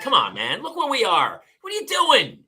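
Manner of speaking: rising disbelief at the litterer; exasperated scold. Retold or imitated.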